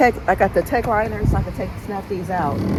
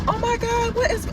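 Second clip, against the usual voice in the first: startled. Real, not acted. Startled in car wash.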